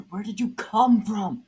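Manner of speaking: high, loud startled scream; startled hero screams at the ghost. A narrator's reading. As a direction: scream in fright. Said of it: "Superman screamed".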